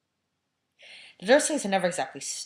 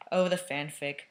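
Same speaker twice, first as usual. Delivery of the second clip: ordinary delivery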